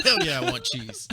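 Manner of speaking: loud, laughing brash American; mock American. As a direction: whoop it through a laugh. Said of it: "What'd they say?".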